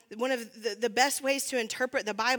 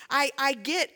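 ordinary delivery

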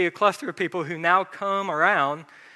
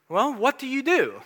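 curious gym buddy; 8 semitones higher, 4 dB louder than usual. Retold or imitated. Gym guy asks pastor's job.

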